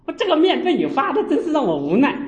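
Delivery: high, loud scolding of a mother; exasperated nagging at mom. Retold or imitated. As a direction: scold her sharply over the dough. Monk scolding mother over dough.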